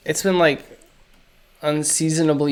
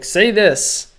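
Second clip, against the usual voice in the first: higher, louder mock prompting; imitated eager request; 6 semitones higher, 5 dB louder than usual. People who noticed your accent prompt you, imitated pestering. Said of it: "they're like".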